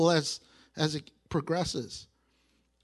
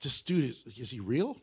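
hushed, lower wondering aside; understated amazement, taken aback; mildly troubled awe; 7 semitones lower, 4 dB quieter than usual. Acted. Young Mary reacting to the angel, quietly stunned. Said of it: "she's not totally freaking out".

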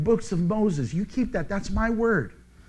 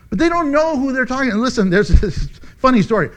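normal talking voice

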